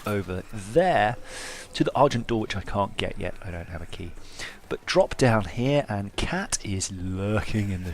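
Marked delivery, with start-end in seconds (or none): none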